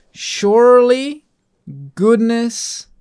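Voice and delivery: slow, lifted, solemn read of the verse; slow and ceremonial; scripture reading. Acted. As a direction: read it out like a psalm in church. Reading the psalm verse aloud.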